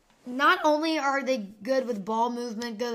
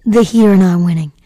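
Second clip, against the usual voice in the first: loud; 4 semitones lower, 16 dB louder than usual. Real, not acted. Emphatic call on the game.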